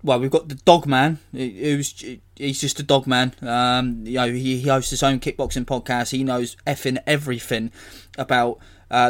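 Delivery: normal talking voice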